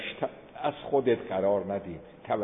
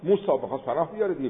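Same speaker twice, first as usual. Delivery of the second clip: usual speaking voice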